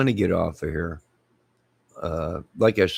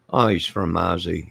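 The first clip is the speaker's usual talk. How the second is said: ordinary delivery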